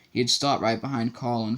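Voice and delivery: ordinary delivery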